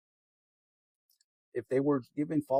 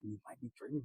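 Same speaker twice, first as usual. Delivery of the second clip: quiet repeat; 8 semitones lower, 8 dB quieter than usual. Real, not acted. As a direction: drop the voice lower and softer. Repeated warning to viewers.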